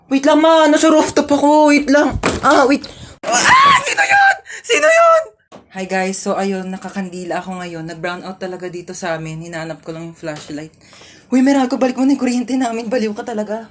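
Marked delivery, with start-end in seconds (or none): 3.2-5.2 high, startled question; frightened. Acted. Scared on dark rooftop. 5.7-10.7 much softer, lower vlog voice; hushed vlog. Acted. Vlogging softly during brownout.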